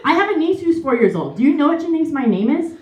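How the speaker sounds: ordinary delivery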